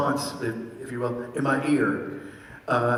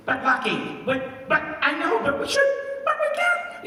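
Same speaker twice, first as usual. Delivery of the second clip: much higher, frantic cartoon bobcat voice, climbing to a squeal; Bonkers' voice. Shown as a demonstration. Demonstrating Bonkers pleading with his partner, squeaky frantic voice. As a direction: plead in a panicky toon squeak. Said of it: "I would do all the Bonkers lines".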